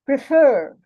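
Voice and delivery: "Prefer" with "fer" stronger and longer; stress on last syllable. Shown as a demonstration. Teacher showing learners a stressed syllable, stress on "fer" in "Prefer". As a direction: lean on the second syllable of "Prefer". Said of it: "The fur is stressed".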